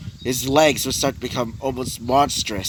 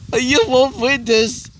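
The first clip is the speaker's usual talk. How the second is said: raised, high storyteller's yell; acted screaming; 9 semitones higher than usual. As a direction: shout defiance at your enemy, hammed-up. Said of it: "start screaming out".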